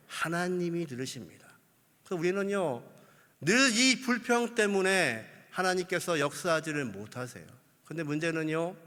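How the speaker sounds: usual speaking voice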